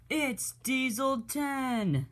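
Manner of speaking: slow, very high gasp of dread; high, drawn-out terrified whisper-cry of the villain's name; in horror. A narrator's reading. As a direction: stretch out the name, frozen with fright. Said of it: "stood still in horror".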